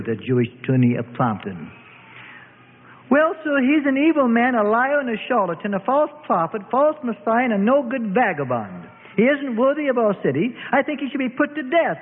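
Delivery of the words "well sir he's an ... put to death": higher, blurted mock outrage; put-on fury, spilling it out; feigned rage. A narrator's reading. Woman witness blurts feigned rage at the court. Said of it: "she blurted out in feigned rage".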